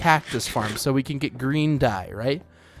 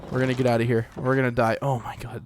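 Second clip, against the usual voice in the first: usual speaking voice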